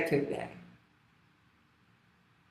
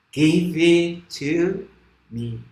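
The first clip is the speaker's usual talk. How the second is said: slow animal demand; 3 dB louder, much slower than usual. Acted. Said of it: "all the animals, they say".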